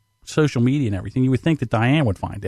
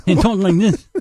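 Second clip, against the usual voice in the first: injured boss impression; 7 semitones higher, 4 dB louder than usual. Mechanic demonstrates how his hurt boss talked, high impression. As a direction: imitate the boss's odd high speech. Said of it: "and he was talking, he talked like this".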